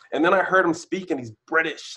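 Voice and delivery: ordinary talk, nothing marked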